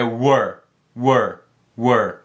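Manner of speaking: "were" said slowly and low, one word at a time; teacher drilling "were"; pronunciation drill. Shown as a demonstration. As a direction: model "were" slowly and clearly. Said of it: "você pode começar pronunciando assim".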